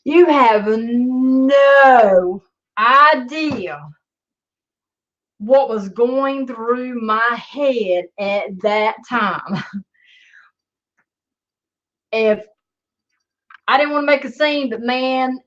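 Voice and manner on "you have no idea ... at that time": drawn out. Real, not acted. Drawn-out emphatic aside.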